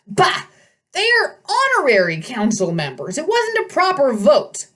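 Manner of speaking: put-on high, indignant old satyr voice; outraged old goat-man shouting; fussy old satyr. A narrator's reading.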